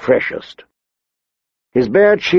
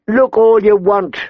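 high, mocking guard voice; second winchman; 9 semitones higher than usual. A narrator's reading. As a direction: taunt the newcomer from above. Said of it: "the other one said".

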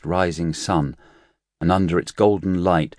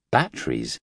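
puzzled; 5 semitones lower than usual. Acted. Nurse echoes unknown word.

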